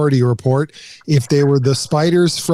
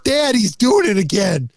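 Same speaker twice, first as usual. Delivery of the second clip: imitating an upset kid tattling to dad; high-pitched, whining imitation of a son; worked-up son; 8 semitones higher than usual. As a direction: voice your kid's outrage, pitched well up. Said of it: "He was getting so worked up".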